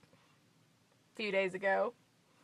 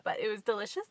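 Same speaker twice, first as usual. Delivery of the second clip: ordinary talk, nothing marked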